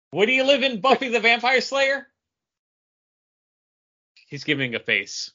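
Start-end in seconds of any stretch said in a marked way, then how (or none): 0.1-2.1 high, raised question shouted across; calling out. Real, not acted. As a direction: call the question over to her.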